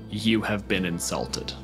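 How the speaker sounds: ordinary delivery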